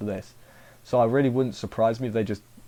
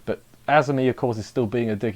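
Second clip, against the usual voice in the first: ordinary talk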